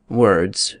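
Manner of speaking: lightly voiced Hamlet repeating himself; Hamlet, weary. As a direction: say "words," flatly, drifting off. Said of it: "Hamlet replied".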